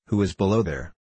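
a lightly voiced bully at the window; big rough voice. A narrator's reading. Roused bully calls down to the stranger, rough-voiced. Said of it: "in a terrible big rough voice".

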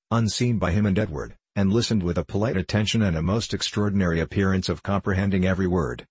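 plain reading voice

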